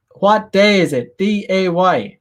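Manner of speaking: slowed-down question with the word day spelled out; slow emphatic repeat. Retold or imitated. Teacher re-asking high schoolers the date, slow and spelled out. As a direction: spell out D A Y slowly and pointedly, like a patient teacher.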